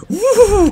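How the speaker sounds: high, loud, drawn-out whoop; excited whoop. Real, not acted. Player hyped in a fight, excited whoop. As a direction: whoop as you swing in. Whoop during game attack.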